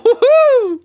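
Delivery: squealed woohoo, very high and loud; laughing woohoo. Real, not acted. Whooping as the final Pokemon is announced, loud and laughing.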